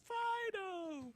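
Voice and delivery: high-pitched, hammed-up joyful cry; elated commercial guy. Man as his dog jumps into his arms, mock elation. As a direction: call it out high like an overjoyed ad character. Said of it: "the greatest day of his life".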